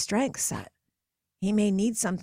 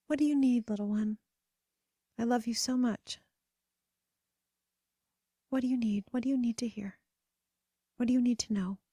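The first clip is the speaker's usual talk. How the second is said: tender questions; 3 semitones higher, 4 dB quieter than usual. Pretend. Comforting her imagined inner child.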